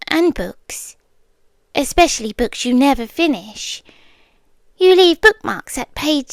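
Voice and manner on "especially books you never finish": usual speaking voice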